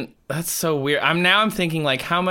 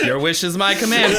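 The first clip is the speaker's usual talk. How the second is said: genie; 6 semitones higher, 4 dB louder than usual. Pretend. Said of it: "if a genie comes to me".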